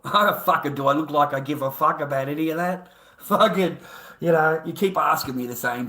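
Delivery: loud, fed-up angry retort; real exasperation. Real, not acted. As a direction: throw the question back loudly, exasperated. Fed up with repeated questions.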